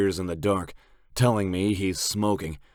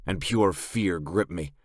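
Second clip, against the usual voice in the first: ordinary delivery, nothing marked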